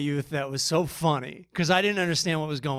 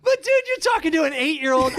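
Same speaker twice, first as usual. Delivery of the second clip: higher-pitched exasperated objection; mock indignant protest; 9 semitones higher, 4 dB louder than usual. Swimmer objecting to coach's demand of a child, higher mock indignation. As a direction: protest in hammed-up disbelief.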